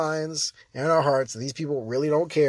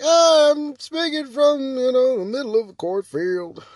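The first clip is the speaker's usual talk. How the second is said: mock far-off speaker; 10 semitones higher, 5 dB louder than usual. Mocking a distant-sounding audio.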